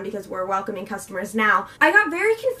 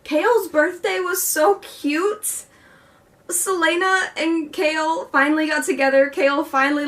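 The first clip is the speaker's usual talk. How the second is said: gushing; 5 semitones higher than usual. Real, not acted. Gushing over cute book moment.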